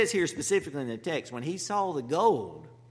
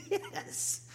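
breathy high laugh into a gleeful yes; imitating a thrilled servant; excited; 6 dB quieter than usual. Servant thrilled when Rebekah waters the camels, excited. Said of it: "Oh, he's excited".

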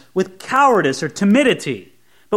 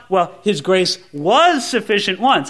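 emphasize WAS as a thing of the past; stress on WAS. Acted. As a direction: lean on was, as opposed to is. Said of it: "You thought".

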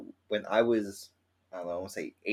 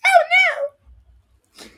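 high shriek; 13 dB louder than usual. Real, not acted. Shriek at quote.

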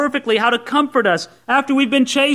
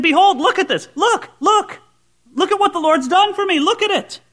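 much higher, eager call to look; urgent behold; 10 semitones higher than usual. Retold or imitated. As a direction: grab them by the lapels and make them look. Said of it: "he's grabbing you by the lapels and he's saying".

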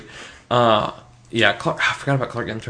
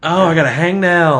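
mock whiny complaint; 7 semitones higher, 7 dB louder than usual.

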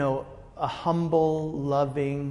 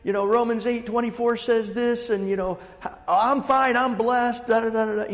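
bright, higher, rushed churchy hello; chipper churchgoer greeting; 6 semitones higher than usual. Retold or imitated. Man at the coffee shop gushing blessings, mock-cheery imitation. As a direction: mimic an overly cheerful believer. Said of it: "and he's like".

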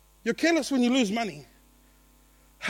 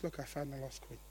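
much softer and lower, gentle joy; tender finding; 9 semitones lower, 16 dB quieter than usual. Acted. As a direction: say it softly, like God cradling the coin. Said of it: "he pulls out the coin and he goes".

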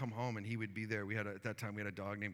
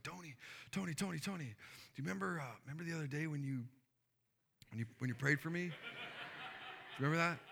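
higher, eager rush of words; excited calling out; 5 semitones higher, faster than usual. Retold or imitated. Neighbor rushing over to tell the pastor, excited and higher. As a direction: burst out with breathless news.

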